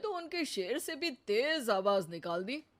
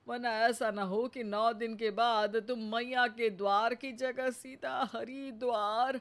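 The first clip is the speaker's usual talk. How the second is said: ordinary talk, nothing marked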